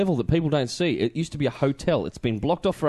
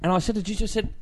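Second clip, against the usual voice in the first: ordinary talk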